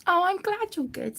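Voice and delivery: ordinary talk, nothing marked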